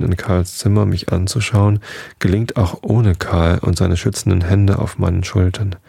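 usual speaking voice

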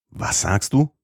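high, startled cry of emotion; overwhelmed exclamation. Acted. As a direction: burst out, shaken to the core. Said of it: "rief er in unbeschreiblicher Gemütsbewegung". (hoch und erregt ausgerufen)

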